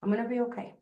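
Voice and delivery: soft, comforting shush to yourself; calm yourself down like a child; soothing self-talk. Shown as a demonstration. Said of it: "just go down the arms and just say".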